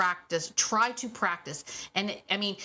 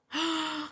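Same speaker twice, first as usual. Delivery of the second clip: mock gasp; 8 semitones higher than usual. Mock shock at own point.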